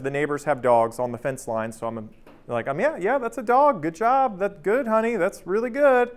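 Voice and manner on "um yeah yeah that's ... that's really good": higher-pitched parent praise voice; imitated encouraging dad. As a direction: humour a toddler with high-pitched praise. Dad absently praising his small daughter.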